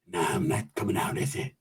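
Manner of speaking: low, very soft mumbled answer; muted mock reply. Voices a hidden reply, very low.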